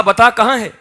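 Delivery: loud, high demand for an answer; angry demand. Retold or imitated. Parent questioning son about the lost coin, angry demand. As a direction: act out an impatient interrogation.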